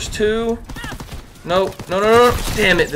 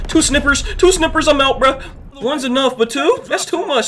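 alarmed disbelief; 6 semitones higher than usual. Real, not acted. Alarmed at facing two snipers.

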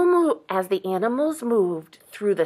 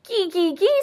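high bird-like chirps; chickadee; 9 semitones higher than usual. A narrator's reading. Chickadee calls out from snowman, high chirping. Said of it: "said the chickadee dee".

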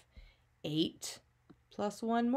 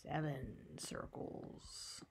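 whispering; 6 semitones lower, 14 dB quieter, much slower than usual. Real, not acted. Teacher counting quietly to herself.

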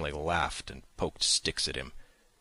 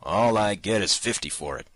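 grumbling; 5 semitones higher, 7 dB louder than usual. A narrator's reading. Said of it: "he grumbled".

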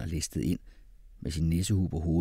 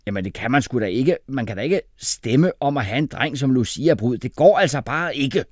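loud, raised, indignant; heated, higher-pitched objection; shouted protest; 5 semitones higher, 8 dB louder than usual. A narrator's reading. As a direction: refuse it outright at volume. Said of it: "råbte Jeppe".

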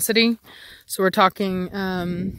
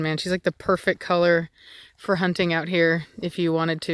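ordinary delivery

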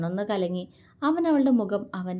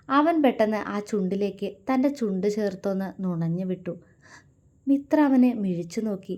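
ordinary delivery

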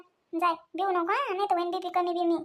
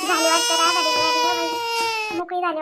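slow, louder tearful wail; crying with relief; 4 dB louder than usual. Acted. Mother-in-law hears the birth, tearful joyful crying. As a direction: weep out the relief as the news comes.

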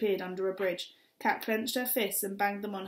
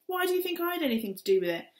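defensive outburst; 10 semitones higher, 4 dB louder, faster than usual. Acted. Man defends himself.

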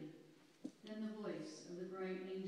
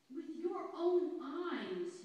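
slow, louder, higher angry outburst; angry cry; 7 semitones higher, 8 dB louder, much slower than usual. A narrator's reading. Angry eldest shepherd scolding Amos, loud slow cry. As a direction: hurl each word at him slowly. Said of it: "he cried out".

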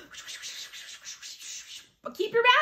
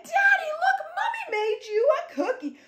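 put-on excited child; 9 semitones higher than usual. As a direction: show Daddy the cookie proudly. Child proudly shows cookie.

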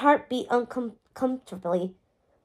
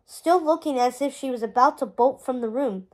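ordinary delivery

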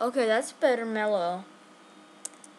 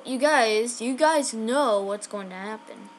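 ordinary delivery

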